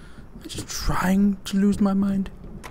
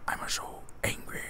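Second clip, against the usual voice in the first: very soft whispered pretend anger; whispered mock anger; 15 dB quieter than usual.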